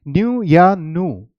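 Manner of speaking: न्यू with a y-glide, then नू without it, slowly; two pronunciations. Shown as a demonstration. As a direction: contrast न्यू and नू, drawn out. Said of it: "दोनों इसकी pronunciation है". (धीरे से न्यू और फिर नू, दोनों उच्चारण)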